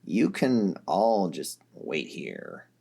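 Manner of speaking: low, slow voice for Vriska. A narrator's reading. Vriska, after a heavy breath, low and slow.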